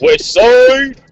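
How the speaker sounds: mock shout. Jokey shout after West Coast talk.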